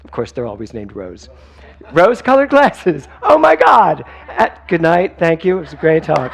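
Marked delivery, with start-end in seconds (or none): 1.9-6.3 high, loud, over-the-top gushing praise; mock a gushing churchgoer, high and loud; gushing mock friend. Mocking Rose gushing.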